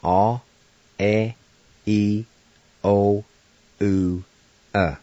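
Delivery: Cherokee vowels A, E, I, O, U, uh said one by one, each clear and held. Shown as a demonstration. Teacher modelling the six Cherokee vowels for learners, slow and clear. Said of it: "here are the pronunciations".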